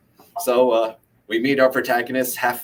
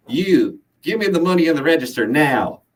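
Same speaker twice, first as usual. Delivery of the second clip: higher, louder Southern robber's demand; loud booming voice; 6 semitones higher, 5 dB louder than usual. A narrator's reading. Gunman orders cashier to empty the register, booming Southern voice. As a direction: boom it out in an amateur Southern accent. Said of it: "then a loud booming voice".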